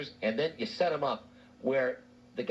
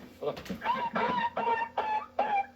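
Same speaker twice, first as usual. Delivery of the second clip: high yelps of a kicked dog; string of mock high-pitched dog yelps; kicked-dog sound. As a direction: imitate a dog yelping at each kick. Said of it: "that was you making that sound".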